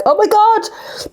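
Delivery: slow, high, drawn-out disbelief; stunned oh my god. Retold or imitated. Me and Mum stuck on the ride, reacting in high shock. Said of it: "Me and Mum are like".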